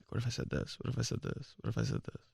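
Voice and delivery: muttering lines under his breath; whispered rehearsal. Retold or imitated. Practicing lines to a girl in a whisper. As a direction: rehearse a pickup line in a hushed whisper.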